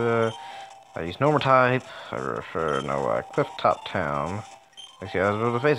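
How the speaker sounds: normal talking voice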